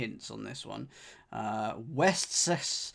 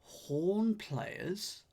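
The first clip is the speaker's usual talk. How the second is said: ordinary delivery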